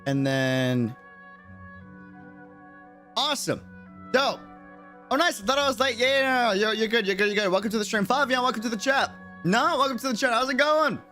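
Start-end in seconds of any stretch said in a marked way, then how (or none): none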